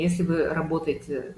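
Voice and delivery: normal talking voice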